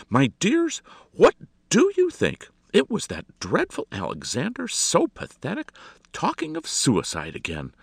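high, loud gossip to her guests; affected lady, delightedly appalled; gushing society hostess. A narrator's reading. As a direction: dish the scandal with dramatic scorn.

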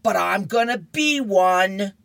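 put-on stubborn girl hollering her last word; screaming out. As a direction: shout it back while walking away. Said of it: "she screams out".

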